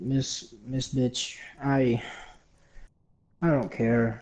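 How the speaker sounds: low, soft, deadpan retort; unimpressed backtalk. Retold or imitated. Student answers teacher's threat of trouble, acted weary indifference.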